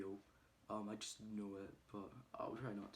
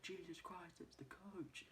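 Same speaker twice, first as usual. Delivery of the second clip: higher, softer stunned gasp; awed surprise; 8 semitones higher, 9 dB quieter than usual. Retold or imitated. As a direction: realise in hushed shock. Louts realising who he is.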